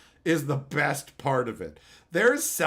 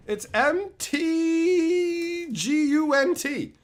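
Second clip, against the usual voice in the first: slow spelling; 10 semitones higher, 4 dB louder, much slower than usual. Real, not acted. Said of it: "spelling out the wheel result".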